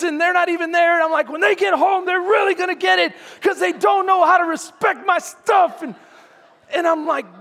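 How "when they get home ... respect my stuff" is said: high, shouted threat; hammed-up angry dad shout; yelling at kids. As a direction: rant like a fed-up dad at full pitch. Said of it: "like, yelling at the kids".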